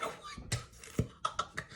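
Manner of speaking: slow, drawn-out unvoiced gasp of disbelief; whispered shocked what the fuck; stunned whisper. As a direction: let it out slowly like you can't believe it.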